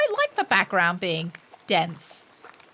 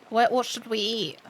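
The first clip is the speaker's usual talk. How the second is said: normal talking voice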